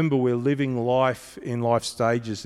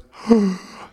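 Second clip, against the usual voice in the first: startled gasp; 8 semitones higher, 5 dB louder than usual. Retold or imitated. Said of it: "the rabbit wakes up and goes".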